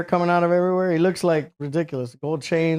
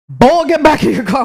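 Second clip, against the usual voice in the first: booming, high-pitched cartoon Southern bluster; loud, hammed-up cartoon command; Foghorn Leghorn; 9 semitones higher, 8 dB louder than usual. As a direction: bellow like a blustering giant trooper. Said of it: "he's Foghorn Leghorn as a human".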